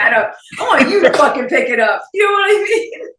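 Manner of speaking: loud, high mock outburst; playful demand. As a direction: shout it in joking exasperation. Playful demand that he lift couch.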